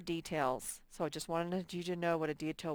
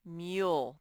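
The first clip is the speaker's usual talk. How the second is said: ordinary talk, nothing marked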